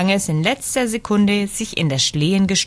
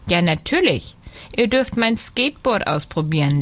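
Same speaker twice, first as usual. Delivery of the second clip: usual speaking voice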